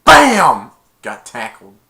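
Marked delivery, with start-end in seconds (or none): 0.0-0.7 loud high bam like a hit; mock tackle sound effect; impact noise. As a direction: punch out the crash sound.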